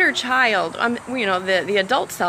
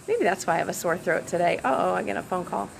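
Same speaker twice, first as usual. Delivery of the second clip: ordinary talk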